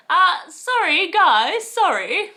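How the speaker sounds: loud, high, slow "sorry, guys" over the room; calling the room. Shown as a demonstration. Getting the room's attention, loud and slow. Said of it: "trying to get control of a room".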